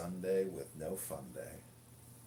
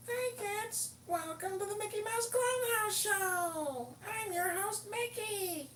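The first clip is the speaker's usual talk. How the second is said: much higher, slow cartoon-host squeak; Mickey Mouse; slower than usual. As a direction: greet the kids like a cheerful cartoon mouse. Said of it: "He sounds like Mickey Mouse".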